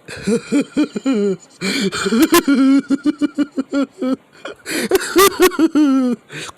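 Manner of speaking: high, loud villain cackle; Shigaraki creepy laugh. Acted. Villain laughing at his hurt opponent, creepy cackle. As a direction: let out a long, shrill-pitched creepy cackle. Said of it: "Shigaraki starts laughing".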